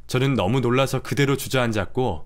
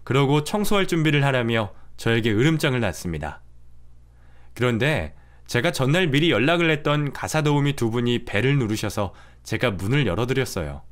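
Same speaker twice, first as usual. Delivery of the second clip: ordinary delivery, nothing marked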